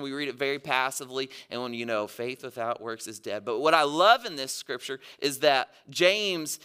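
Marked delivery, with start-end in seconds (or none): 2.1-3.5 low, quiet, passive monotone; imitating a dull Bible reader; monotone reading. Shown as a demonstration. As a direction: read it passively, low and without expression. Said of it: "we read it very monotone".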